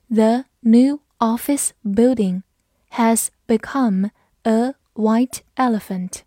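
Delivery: slow, word by word, with clear gaps; slow read-through. Shown as a demonstration. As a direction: read it out slowly for learners. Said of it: "好，我们慢读一遍". (慢读一遍，逐词清晰)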